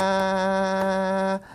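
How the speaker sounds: 아야 stretched very slowly, each vowel held; 아야 pronounced very slowly and deliberately; spelling-out pronunciation. Shown as a demonstration. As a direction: draw 아야 out slowly to show the sound. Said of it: "이, 이렇게 씁니까?".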